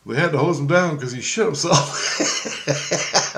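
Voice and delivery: slow, laughing through the words; retelling the camper's mishap to the listener, laughing. Retold or imitated. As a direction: barely hold back laughter as you say it.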